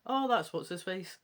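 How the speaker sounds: ordinary delivery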